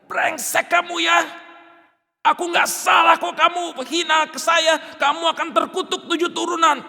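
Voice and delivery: furious high shout, slowed; cursing. Pretend. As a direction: hurl curses at the tormentors. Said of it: "Apakah dia memaki-maki?".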